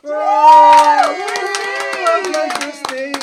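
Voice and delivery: very high, loud whoop; excited cheering. Real, not acted. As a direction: scream with delight. Cheering the top winner.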